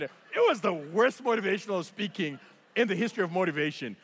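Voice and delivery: ordinary talk, nothing marked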